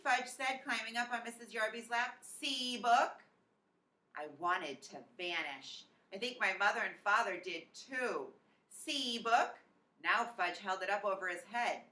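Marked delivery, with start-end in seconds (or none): 2.2-3.2 lightly voiced toddler, proud and rising; a little boy showing off his book, a touch louder at the end; toddler Fudge. A narrator's reading. 8.7-9.5 lightly voiced toddler, proud and rising; a little boy showing off his book, a touch louder at the end; toddler Fudge. A narrator's reading.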